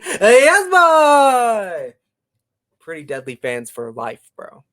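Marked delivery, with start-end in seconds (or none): 0.0-1.9 high, drawn-out hype yell; shouted catchphrase. Retold or imitated. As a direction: holler it like a wrestling catchphrase. Shouts Pretty Deadly's catchphrase.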